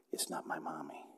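put-on a frightened child's soft whisper; a toddler whispering to himself in panic; scared whisper. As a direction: breathe it out in panic, barely voiced. Child realising stranger in dress.

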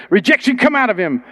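high, firm command to the spirit; commanding deliverance. Retold or imitated. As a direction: order it out with authority.

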